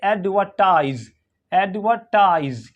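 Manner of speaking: slow एडवर्टाइज with a silent R; British pronunciation. Shown as a demonstration. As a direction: draw out एडवर्टाइज as "AD-vuh-tize". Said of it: "British English में नहीं पढ़ा जाता".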